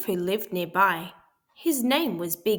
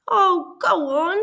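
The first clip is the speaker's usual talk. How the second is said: high, louder sniffly begging; sniffling plea; 9 semitones higher, 8 dB louder than usual. A narrator's reading. Sick wolf begs the pig for a tissue, sniffling. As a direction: beg through a runny nose. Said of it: "sniffled Big Bad".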